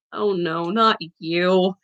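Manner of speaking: low, soft groaning dismay; moaning. A narrator's reading. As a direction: moan it out in weary dread. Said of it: "he moaned".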